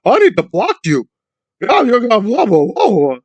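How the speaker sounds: high, loud sneering imitation; mocking Dana. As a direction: pitch it up and whine like the blocker. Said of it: "Whenever they have too many alarming questions".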